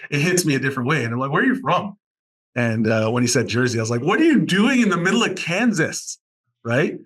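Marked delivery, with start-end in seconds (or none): 1.3-2.0 taken aback. Retold or imitated. Retold reaction to a Jersey accent. 4.0-6.2 high-pitched retold disbelief; incredulous, pitch leaping up. Retold disbelief at Jersey student.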